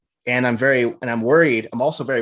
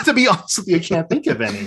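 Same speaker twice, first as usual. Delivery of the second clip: higher-pitched, talking through real laughter; laughing admission; 8 semitones higher, 4 dB louder than usual. Real, not acted. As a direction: admit it while cracking up. Laughing while admitting he's stumped.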